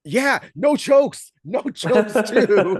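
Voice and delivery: high, loud, slow mock shout breaking into a laugh; mocking yell back. Coach jokingly yells back at the ref, slow loud laughing. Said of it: "And I go like".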